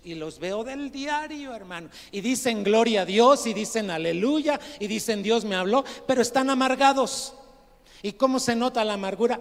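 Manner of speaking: high, very loud pulpit outburst; heated, exclaiming sermon delivery; full-force preaching. Real, not acted. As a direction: build to a shout as you list their pious phrases.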